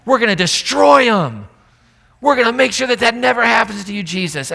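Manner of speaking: loud, cocky battle boast; brash boast. Acted. Peter boasting to Jesus, a loud brash vow. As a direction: swagger like you'll wipe them out. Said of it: "Peter's like".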